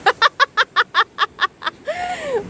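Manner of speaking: high staccato giggle; long burst of high, pulsing laughter; laughing. Real, not acted. Mother amused by her child's chatter, laughing.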